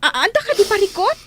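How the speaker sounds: calls out high and loud, near tears; alarmed crying call. Acted. Mother calling to her distressed daughter, alarmed and tearful. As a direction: cry her name in alarm.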